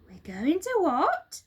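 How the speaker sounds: put-on children can't believe it; astonished children. A narrator's reading. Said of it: "they cried".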